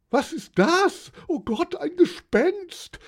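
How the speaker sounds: very high, loud shriek of horror; frightened scream. A narrator's reading. Wife spotting a ghost at the window, shrieking. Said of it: "schrie Johanna Elisabeth von Reichberg".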